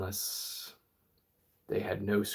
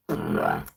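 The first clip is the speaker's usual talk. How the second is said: deep gritty inward bass note; grimy inward bass; 6 dB louder than usual. Shown as a demonstration. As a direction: drop into a grimy textured bass. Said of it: "to this grimier, more textured sound".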